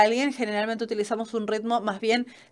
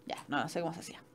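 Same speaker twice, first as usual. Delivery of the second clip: much softer, talking under her breath; muttering to herself; 2 semitones lower, 15 dB quieter, faster than usual. Real, not acted. Teacher fiddling with her slides, muttering quietly to herself. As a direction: mumble it as a private aside while fumbling.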